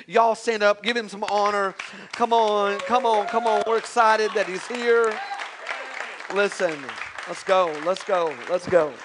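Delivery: loud rallying call to stand and cheer; crowd hype. Real, not acted. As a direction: pump the room up for the guest. Hyping crowd to welcome guest.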